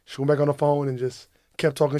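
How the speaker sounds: ordinary talk, nothing marked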